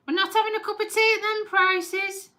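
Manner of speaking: high-voiced retold refusal; imitated mother. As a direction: mimic a mum balking at the cost.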